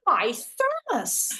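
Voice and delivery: slow, high exclamation; delighted surprise. Acted. Spotting her childhood thermos on the riverbank, slow amazed cry. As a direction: stretch it out in amazed recognition.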